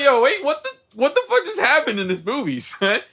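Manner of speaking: high-pitched, baffled outburst; hammed-up bewilderment after laughing; mock disbelief. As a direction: react in exaggerated, high-voiced shock. Acting out own bewildered reaction, laughing.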